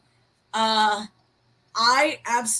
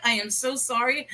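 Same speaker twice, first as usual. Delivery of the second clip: ordinary talk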